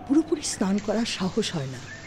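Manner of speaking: ordinary delivery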